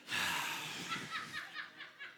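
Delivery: exasperated sigh. Real, not acted. End of cat story.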